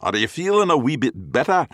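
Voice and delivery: thick Glaswegian, high and loud; Glaswegian accent. Acted. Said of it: "chattering in thick Glaswegian accents".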